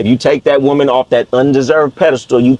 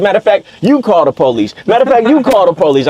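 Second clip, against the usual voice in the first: rising, firmer repeat; retold challenge; 6 semitones higher than usual. Man daring the crying woman to call police. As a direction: dare her to call the cops. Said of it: "I said".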